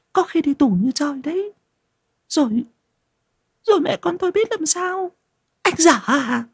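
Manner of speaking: high, panicky wife voice; startled and worried. Acted. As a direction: fret in a rising panic. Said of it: "Thu giật mình sửng sốt".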